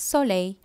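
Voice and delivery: French "soleil", so-lay, final l unsounded; French pronunciation. Shown as a demonstration. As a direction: end "soleil" on a y sound. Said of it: "சூரியனை ஃப்ரெஞ்சில்".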